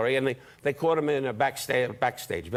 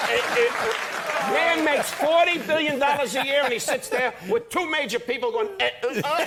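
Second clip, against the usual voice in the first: high, loud mock-outraged rant; exasperated comic rant; 9 semitones higher, 3 dB louder than usual. Comic heckling the fumbling talk show host, exasperated. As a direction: raise it into a heckling complaint.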